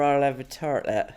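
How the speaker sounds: rustic Somerset tartlet; put-on Somerset burr; Somerset accent. Shown as a demonstration. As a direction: say TARTLET in a West Country accent. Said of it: "you've gone to a Somerset accent".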